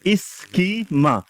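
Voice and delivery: इसकी माँ, slowly drawn out as two separate words; schema split as two words. Shown as a demonstration. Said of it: "जैसे अंग्रेजी में अगर इसका वैसे फोनेटिक्स नहीं बनाते".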